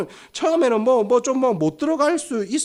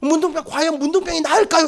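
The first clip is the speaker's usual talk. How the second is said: high, suspenseful live commentary; sports commentator; 7 semitones higher, 3 dB louder than usual. Acted. Commentator wonders whether the patient will heal, mock live call. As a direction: ask it to viewers, pitched up. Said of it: "중계가 시작될 거예요".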